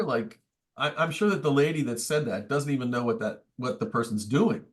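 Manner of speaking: ordinary talk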